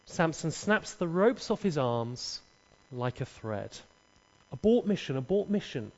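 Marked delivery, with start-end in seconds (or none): none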